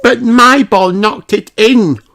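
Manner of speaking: put-on high, bright little girl voice; childlike high voice; Susie Sheep voice. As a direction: play the young sheep insisting she won.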